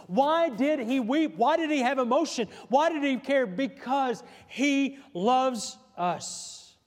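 preacher building to a ringing, drawn-out declaration; impassioned preaching. Acted. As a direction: hammer out each question, then draw out the answer. Climax of the sermon's questions.

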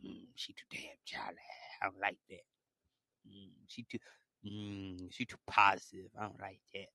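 low, hushed demon whisper. Retold or imitated. The jealous girl's demon whispering its grudge. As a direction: murmur it like something sly inside her. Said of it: "Her demon coming out right now".

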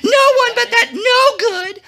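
very high falsetto denunciation; accusing queen. A narrator's reading. Queen exposing the villain to the king, high and emphatic. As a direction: pile up insults in a shrieky falsetto. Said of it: "pointed and said".

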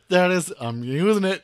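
words squeezed through laughter; laughing while talking. Retold or imitated. Laughing retelling own joke.

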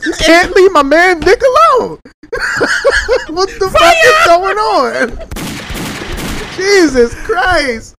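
laughing disbelief. Real, not acted. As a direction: crack up in disbelief. Cracking up in disbelief.

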